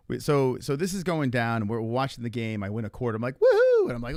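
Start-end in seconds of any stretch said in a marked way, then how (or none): 3.4-3.8 very high, loud "woohoo"; celebratory whoop. Retold or imitated. Reliving winning a quarter, celebratory whoop. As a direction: whoop it out at full pitch.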